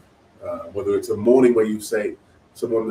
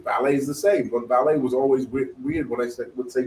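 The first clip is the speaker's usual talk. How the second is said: usual speaking voice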